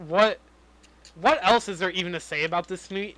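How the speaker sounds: ordinary talk, nothing marked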